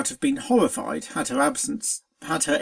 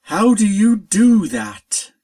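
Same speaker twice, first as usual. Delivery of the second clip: loud, slow exasperated cry; 3 semitones higher, 9 dB louder, slower than usual. A narrator's reading. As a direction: throw up your hands in exasperation. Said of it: "came the spirit's exasperated voice".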